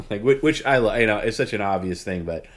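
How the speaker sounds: normal talking voice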